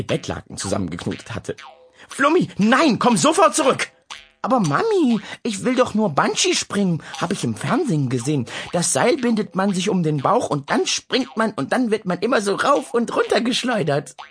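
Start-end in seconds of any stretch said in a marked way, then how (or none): 2.1-3.9 high, loud panicked command; alarmed shout. Acted. Mother shouts at her climbing daughter, loud alarm. 4.4-14.2 put-on high little girl's voice, innocent; small child. Flummi explains bungee jumping to her mother, high innocent child.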